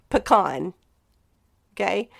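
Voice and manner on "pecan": PECAN as puh-KAHN, second syllable stressed; correct pronunciation. Shown as a demonstration. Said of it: "It is pronounced".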